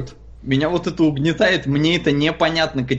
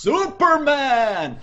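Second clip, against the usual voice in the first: Superman announcer; 9 semitones higher than usual. Retold or imitated. Classic Superman announcer quote.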